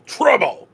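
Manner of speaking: Trouble! barked high and loud; emphatic threat. Acted. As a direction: hit Trouble! like a thunderclap. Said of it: "to emphasize the word trouble".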